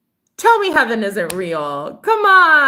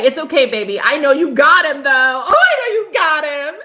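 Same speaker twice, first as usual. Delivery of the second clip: higher, louder teasing coo; teasing praise; 6 semitones higher, 5 dB louder than usual. Real, not acted. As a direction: hype him up playfully. Teasing reassurance to boyfriend.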